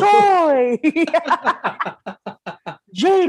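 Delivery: host announcement. Retold or imitated. Host-style name calls.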